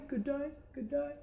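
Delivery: Aussie greeting fading away like an echo. Acted. Game master echoing the player's call back, as a fading Aussie echo. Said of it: "All you hear is".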